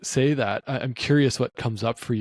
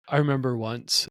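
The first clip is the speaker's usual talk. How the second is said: ordinary delivery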